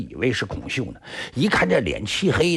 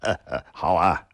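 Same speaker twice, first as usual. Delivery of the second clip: mocking laugh; 5 semitones lower than usual. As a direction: laugh it out piece by piece then sneer. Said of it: "罗烈笑了".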